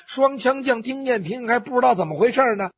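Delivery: ordinary delivery, nothing marked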